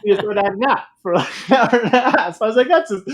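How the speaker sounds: high, loud, through real laughter; laughing while talking. Real, not acted. Telling the host about buddy's nap trick, laughing along. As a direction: crack up delivering the punchline. Laughing at his own punchline.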